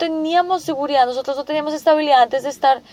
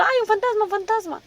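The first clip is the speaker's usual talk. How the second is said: a lightly voiced, higher childish fright; mock the disciples as small scared children; half-hearted childish wail of fear; like frightened little kids; 6 semitones higher, 5 dB quieter than usual. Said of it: "como niños chiquitos diciendo".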